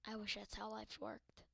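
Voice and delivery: usual speaking voice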